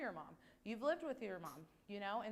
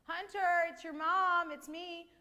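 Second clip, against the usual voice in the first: mock mom voice, higher and louder; pretending to be mom; 7 semitones higher, 6 dB louder than usual. Leader pretends to be Hunter's mom, mock call. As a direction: fake being his mother calling him. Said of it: "So if I was saying".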